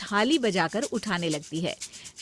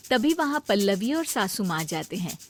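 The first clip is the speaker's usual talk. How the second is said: ordinary delivery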